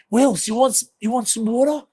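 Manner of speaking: a high, cheeky offer of water; water boy offering drinks. Retold or imitated. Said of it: "he's gone".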